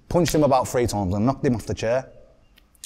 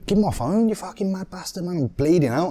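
higher-pitched mock desperate plea; retold panic; 7 semitones higher than usual. Injured lad demanding his phone from attacker, retold panic. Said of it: "I'm panicking now".

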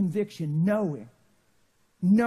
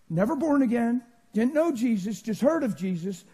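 usual speaking voice

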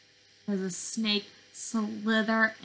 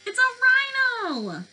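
high, loud delighted shout; big excited reveal for the kids; excited cheer; 13 semitones higher, 5 dB louder than usual. Acted. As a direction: announce the find with glee. Excited discovery for children.

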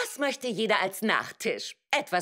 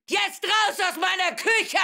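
shouting; 10 semitones higher, 3 dB louder than usual. Acted. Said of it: "was heißt bitte gehen auf Rettisch".

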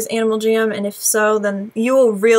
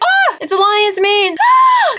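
much higher, louder shout then a shriek; excited scream; 10 semitones higher, 4 dB louder, slower than usual. Real, not acted. Vlogger screaming at winning a lion's mane. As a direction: scream with joy at the prize.

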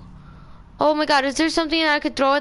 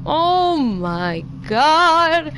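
drawn-out groan; much slower than usual. Real, not acted. After dying again.

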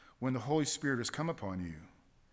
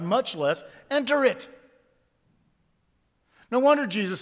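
full-force preaching; 7 semitones higher, 6 dB louder than usual. Real, not acted. Preaching climax, raised voice.

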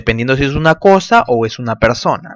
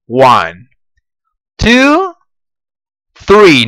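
counting to three; 2 semitones higher, 7 dB louder, much slower than usual. Shown as a demonstration. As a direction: count them in, louder each number. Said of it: "A la cuenta de tres".

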